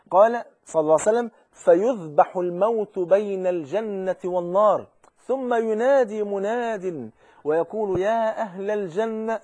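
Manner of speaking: slow, ringing sermon delivery; fervent preaching. Acted. A preacher recites the hadith to listeners, slow fervent preaching. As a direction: preach it out to the congregation.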